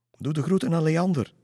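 high, loud call after the horse. Acted. Lisse calls after the departing horse, loud and high. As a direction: shout it after her as she runs off. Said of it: "riep Lisse Bles na".

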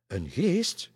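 high, loud, rising echo; startled question. A narrator's reading. Three ladies echo the pastor, startled question. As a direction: ask "geest" back in disbelief.

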